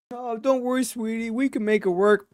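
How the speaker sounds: high, sugary mock-sweet voice; fake sweet act. Husband faking sweetness to his wife, mock sugary voice. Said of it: "you put on the act, this whole fake shit".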